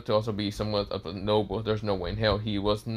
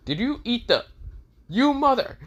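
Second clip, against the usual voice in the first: very high, disbelieving, cut off mid-curse; high-pitched indignant accusation; incredulous outrage; 13 semitones higher, 4 dB louder than usual. Acted. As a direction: splutter it in rising outrage. Draken discovers the eaten card.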